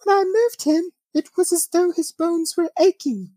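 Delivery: high, put-on British accent as the mother; British woman. Host quoting the mother, in a high British accent. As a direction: quote her in a lifted British woman's accent. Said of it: "I gotta say it in a British accent".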